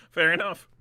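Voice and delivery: chuckling it out; laughing. Real, not acted. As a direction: laugh through the words. Laughing at opponent's lucky play.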